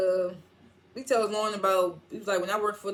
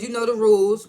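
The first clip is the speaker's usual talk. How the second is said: much louder, hammered "you know the rules"; mock stern shout; loud scolding; 9 dB louder than usual. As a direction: lay down the law. Acted confrontation.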